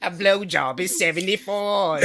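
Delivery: slow, hammed-up English accent; mock British accent. Redoing the price after being teased, slow British accent. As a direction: draw it out in exaggerated British. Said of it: "It was England".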